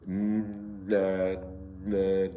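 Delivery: na with a long nasal hum on the n; held ghunnah. Shown as a demonstration. Teacher drills the doubled noon, na with held nasal n. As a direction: hum through the n before opening into na. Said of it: "So we have to say".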